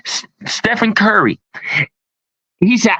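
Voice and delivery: ordinary talk, nothing marked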